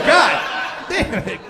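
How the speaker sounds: mock fury at the health system; exasperated outburst. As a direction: blow up at the clinic half-laughing. Exasperated rant at the clinic.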